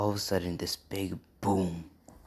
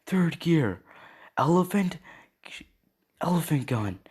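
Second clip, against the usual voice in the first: high acted attack call, easing off at the end; anime-style yell that tapers down; battle yell; 9 semitones higher than usual. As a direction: call out your finishing move, starting high. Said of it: "you hear him yell".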